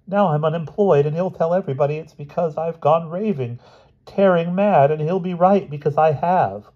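high-pitched wail of self-pity; moaning dismay. A narrator's reading. Mother unemployed and sure she's mad, moaning. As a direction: lament like all is lost.

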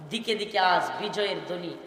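ordinary talk, nothing marked

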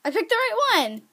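high, pleased outburst; delighted exclamation. Real, not acted. Gamer finds the guess was correct, delighted high exclamation. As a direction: exclaim it in happy surprise.

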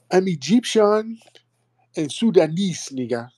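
sarcastic high parody; mocking impression. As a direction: ham up the fake claim, high. Host parodies fake ancestry claims.

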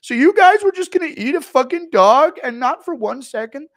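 high, loud whiny outrage; Schmitty impression. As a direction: shriek it like he's been betrayed. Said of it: "Impression of Schmitty finding out".

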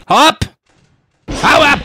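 sudden high panicked yell at the animatronic; scared shout. Real, not acted. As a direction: shoo it away in real panic. Chased by Foxy in game.

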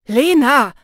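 sharp loud bark of her name; snapping at her. Acted. Jannis snapping at Lena to stop her, sharp and loud. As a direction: cut her off hard. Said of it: "fuhr er mich an".

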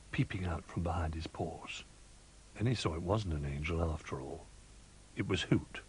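plain reading voice

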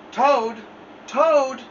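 high, loud calling out; shouting. A narrator's reading. Frog shouting at Toad's door to wake him. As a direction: call him awake with a shout. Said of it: "shouted Frog".

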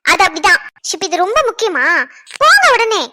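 loud, firm command; wife ordering her husband out the door, loud; sharp order. Acted. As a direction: shut him down and send him off.

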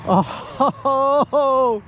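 ordinary talk

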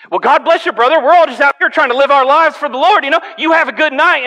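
high, loud, cheerful blessing; suddenly friendly. Retold or imitated. Driver suddenly blessing the pastor, high and cheery. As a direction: sweeten up all at once. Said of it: "His demeanor changed".

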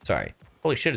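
ordinary talk

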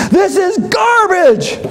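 high, slow scornful verdict; mock Ramsay outburst. Ramsay throwing a plate at the door, mock fury. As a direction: ham up the angry chef.

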